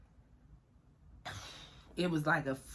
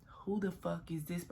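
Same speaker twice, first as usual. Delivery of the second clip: breathy whispered disbelief, barely voiced; hushed what-the-hell whisper; shocked whisper; 8 dB quieter than usual. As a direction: whisper it like you can't believe your eyes.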